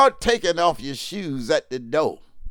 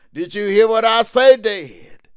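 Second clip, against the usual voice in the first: called out high and loud; loud rising preacher's question; louder preaching call; 5 semitones higher, 7 dB louder than usual. Real, not acted. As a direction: raise the voice to reach the back pews.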